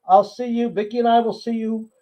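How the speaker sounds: usual speaking voice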